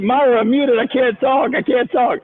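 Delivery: high, flustered re-enactment; high-pitched mock helplessness; mock panic. Retells panicking while muted on call.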